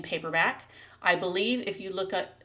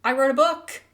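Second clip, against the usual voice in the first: high, loud, gleeful burst; thrilled, much higher and louder; excited announcement; 8 semitones higher, 10 dB louder than usual. Real, not acted. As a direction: announce it with giddy pride. Announcing her first published book.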